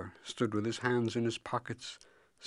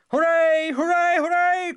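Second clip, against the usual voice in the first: cheering; 13 dB louder than usual. A narrator's reading. People cheer the son's vow, crying out. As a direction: cheer at full voice like a crowd. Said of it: "cried all the people".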